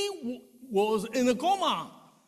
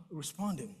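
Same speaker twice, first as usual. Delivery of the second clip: normal talking voice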